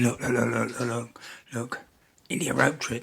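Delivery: lightly voiced comic magician patter; Tommy Cooper. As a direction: patter away like an old stage magician. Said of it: "He said".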